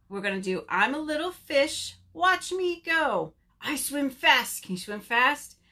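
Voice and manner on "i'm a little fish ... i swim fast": higher, slow rhythmic chant; children's rhyme. Acted. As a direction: lead little ones through an action rhyme.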